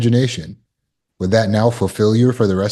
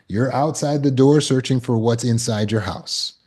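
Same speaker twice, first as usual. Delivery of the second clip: ordinary talk, nothing marked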